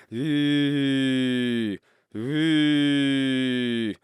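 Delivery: relaxed, open "E" vowel held high. Shown as a demonstration. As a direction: sing an easy, open "E". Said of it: "start by trying to just make an open E sound".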